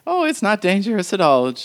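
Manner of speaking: put-on the old woman marvelling that the visitor has never seen a photograph; old woman npc. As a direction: tease the country girl kindly.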